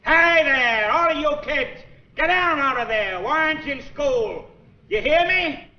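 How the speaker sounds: put-on angry distant shout at misbehaving children; calling out. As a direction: holler at the kids to get down, drawn out. Man yells at kids off.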